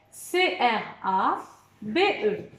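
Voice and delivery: slow, each letter said apart; spelled letter by letter. Shown as a demonstration. Teacher spelling crabe slowly to pupils, letter by letter. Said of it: "Allez, on dit les lettres".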